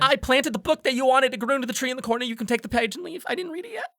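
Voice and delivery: high, hurried character voice; odd greenhouse keeper. Acted. Greenhouse keeper reporting on the planted book, high and hurried. Game master voices Ava.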